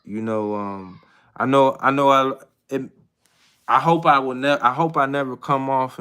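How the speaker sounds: ordinary talk, nothing marked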